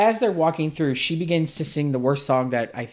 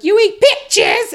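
shrieked way high and loud; ungodly high mock screech; 10 dB louder than usual. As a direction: screech it like an outraged little girl. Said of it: "her voice just screeches so ungodly high".

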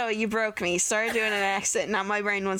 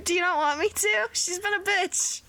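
much higher, squeaky indignation; high incredulous defence; 11 semitones higher than usual. Real, not acted. Player defending a spell on a rude character, high mock indignation. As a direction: argue back high-pitched, half laughing. Laughingly defends zapping the girl.

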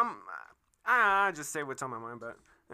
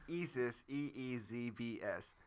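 slow spelling; 5 semitones lower, 9 dB quieter, much slower than usual. Real, not acted. Spelling out handle slowly.

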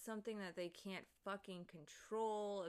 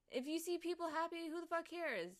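higher and louder, bursting out; excited shout; 9 semitones higher, 8 dB louder than usual. Real, not acted. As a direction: cheer it out at the top of your voice. Happy rant peaks into shouting.

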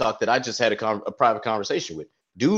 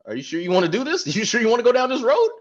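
mock warning; 8 semitones higher than usual. Warning someone mockingly.

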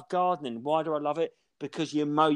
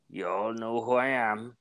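put-on Quint's odd accent; 4 semitones lower than usual. Shark hunter silencing the town hall, low and ominous Quint impression. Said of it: "what was his accent".

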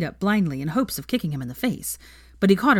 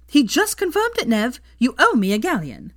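much higher, loud and gleeful; bursting with glee; 7 semitones higher, 5 dB louder than usual. Acted. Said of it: "beside herself with glee".

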